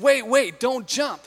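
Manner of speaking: alarmed call. Retold or imitated. Man on the bridge calling to the woman, alarmed. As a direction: call out to stop her jumping.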